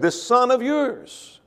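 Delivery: slow, high sneer at this son of yours; slow scornful sneer; mock contempt. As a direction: say it as if he's a stranger. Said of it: "But he said".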